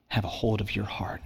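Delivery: hushed, breathy whisper to the congregation. Real, not acted. Preacher pressing the congregation about holding on, hushed whisper. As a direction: draw them close with a whisper. Sermon point on gripping tight.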